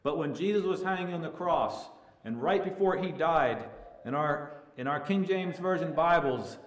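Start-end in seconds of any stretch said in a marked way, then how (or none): none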